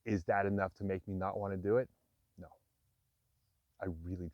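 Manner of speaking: ordinary talk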